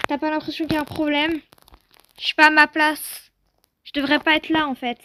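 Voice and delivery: usual speaking voice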